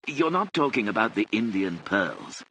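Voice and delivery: usual speaking voice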